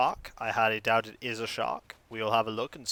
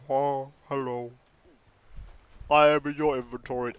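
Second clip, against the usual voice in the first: fish voice. Retold or imitated. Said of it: "It's like".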